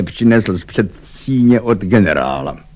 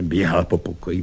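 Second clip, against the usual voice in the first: ordinary delivery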